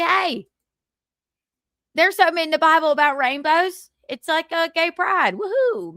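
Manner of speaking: chirpy Southern good-old-boy, lightly played; a hint of Southern twang, upbeat; light mock Southern accent. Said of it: "Southern accent".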